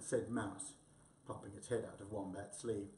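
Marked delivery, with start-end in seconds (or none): none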